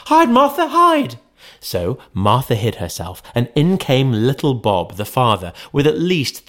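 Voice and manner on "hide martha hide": put-on very high, loud urgent children; children crying out. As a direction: kids hurrying to warn. Children urging Martha.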